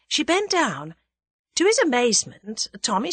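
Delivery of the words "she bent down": usual speaking voice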